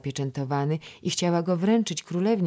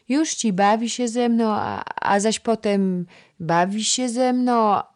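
put-on much higher, louder child's voice; chattering toddler; 7 semitones higher, 7 dB louder than usual. A narrator's reading. Toddler telling the princess about her play, high. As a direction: babble it like a little girl.

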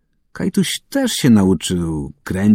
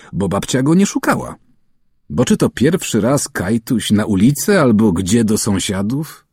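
usual speaking voice

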